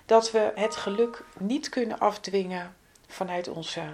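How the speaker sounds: ordinary talk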